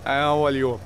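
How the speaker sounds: ordinary delivery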